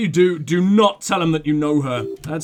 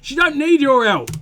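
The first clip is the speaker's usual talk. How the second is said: loud, high scolding; annoyed, forceful telling-off; exasperated rebuke; 7 semitones higher, 6 dB louder than usual. Acted. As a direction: tell him off at raised pitch and volume. Same character scolding.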